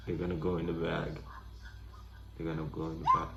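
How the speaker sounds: normal talking voice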